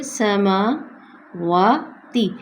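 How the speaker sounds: سماواتی stretched, one alif beat each on meem and waw; careful model reading; slow recitation. Shown as a demonstration. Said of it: "واو پہ بھی ایک الف کے".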